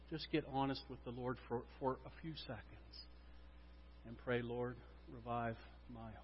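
low, hushed pastoral prayer with long pauses; solemn prayer. Real, not acted. Pastor leads congregation in asking for revival, hushed prayer.

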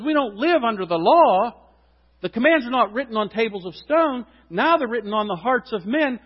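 high, loud fervent preaching; preaching at full force. Acted. Preacher urging the church, loud and fervent. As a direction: drive it home to the crowd.

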